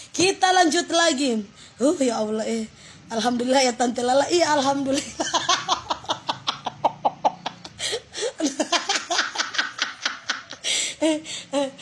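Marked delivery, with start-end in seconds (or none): 5.1-10.9 drawn-out pulsing cackle; long helpless laughter. Real, not acted. Tante Lala giving thanks, long helpless laughing. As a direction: keep cackling until the breath runs out. Relieved, amazed thanks for gifts.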